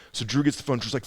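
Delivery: ordinary delivery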